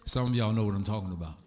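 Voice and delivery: low, steady sermon voice; calm preaching. Acted. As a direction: settle back into teaching, low and calm. Calm low teaching after the rant.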